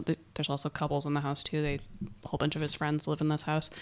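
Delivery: normal talking voice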